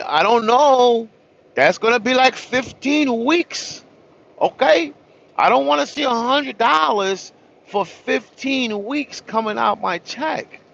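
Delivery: high, slow, disbelieving whine; exasperated plea. Retold or imitated. Retelling his reply to the tow guy, high exasperated plea. Pleading with the tow truck owner.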